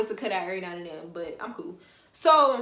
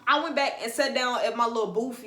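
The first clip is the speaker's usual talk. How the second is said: ordinary delivery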